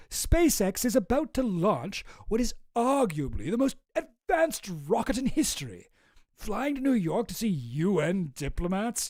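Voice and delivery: hammed-up, drawn-out delivery of a self-important message; mock message voice. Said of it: "do what this message sounds like to me".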